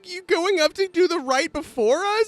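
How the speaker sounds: high, tearful accusation; upset druid accuses Esme, tearful and high; crying npc. Acted. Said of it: "crying npc confronts Esme".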